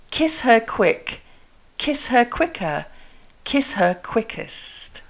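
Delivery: slow "kiss her quick", crisp K; K articulation drill. Shown as a demonstration. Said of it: "What about the K?".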